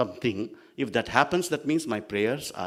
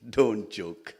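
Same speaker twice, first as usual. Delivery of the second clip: ordinary talk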